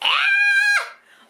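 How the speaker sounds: high laughing shriek of delight; laughing squeal. Real, not acted. Finding the gift she loves, a laughing squeal. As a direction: burst into a happy shriek.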